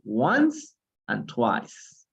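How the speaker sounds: "Once and twice" drawn out very slowly and clearly; slow model. Shown as a demonstration. Teacher modeling vocabulary for the class, very slow. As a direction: stretch out "Once and twice" for learners to copy. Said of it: "teacher models new vocabulary".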